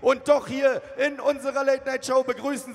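loud, slow, drawn-out guest introduction; announcer hype. Acted. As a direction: boom it out like a ring announcer. Announcing the guests at full force.

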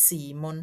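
teacher's careful, soft model of "Simon"; Danish pronunciation. Shown as a demonstration. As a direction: show how "Simon" sounds, low and measured. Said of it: "ออกเสียงว่า".

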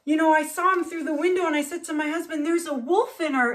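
woman recounting. Retold or imitated. Neighbour tells of a wolf seen through the window, animated. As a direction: play a neighbour excitedly reporting a sighting. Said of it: "She's like".

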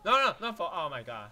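loud high panicked no. Real, not acted. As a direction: cry out in panic. Misclick panic.

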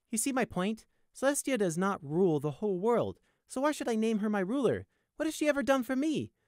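high, rising challenge; indignant high-voiced demand. A narrator's reading. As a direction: press the point, pitch climbing to the end. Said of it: "Prey demanded".

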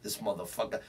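normal talking voice

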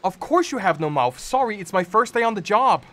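high, bright, eager fairy voice read at speed; cheerful fairy. Acted. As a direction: play a fussy little fairy on her first day. Fairy speaks to new creature.

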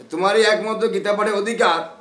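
high, ringing declaration; loud proclamation. Retold or imitated. Mahaprabhu's verdict to the Brahmin, loudly proclaimed.

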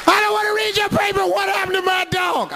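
fast, high yelling; high raging woman; furious shout. Retold or imitated. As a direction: scream it over him.

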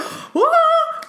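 sudden high gasp of awe; sharp gasp. Real, not acted. Reader gasping at the card, high gasp of awe.

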